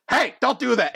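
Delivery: high-pitched startled telling-off; sharp reprimand. Acted. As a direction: call out a cheater mid-act. Judge catches a cheater.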